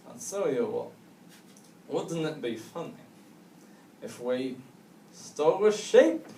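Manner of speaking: lightly voiced drunk with halting pauses; hint of a drunk, rising on the last word; drunk suggestion. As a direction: float a daft idea slowly, phrase by phrase. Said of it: "these two drunks are walking by".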